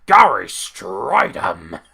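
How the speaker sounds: loud, drawn-out announcer bellow; over-excited commentator mimicry; Vince McMahon impression. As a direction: bellow the name in hammed-up awe. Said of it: "Going full Vince McMahon".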